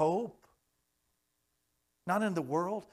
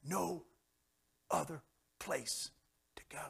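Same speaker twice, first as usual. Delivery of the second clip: exhausted surrender; 2 semitones higher, 5 dB quieter than usual. Retold or imitated. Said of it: "And finally he says".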